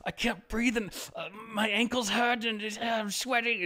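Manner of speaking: whiny, hammed-up distress; imitated freaking out. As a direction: play someone short of air and panicking, voice way up high. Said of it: "was freaking out".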